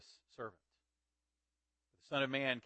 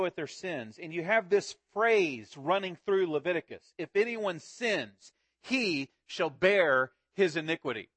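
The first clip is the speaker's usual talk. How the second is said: raised, louder preaching voice driving the point home; preaching at full force; 4 semitones higher, 7 dB louder than usual. Acted. Pastor preaching the Day of Atonement to his congregation, fervent. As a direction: build and preach it to the room.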